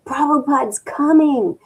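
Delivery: high, loud joyful announcement; imitating a girl screaming on the stairs; excited scream. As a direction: call out the great news, thrilled. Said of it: "a girl running up the stairs screaming".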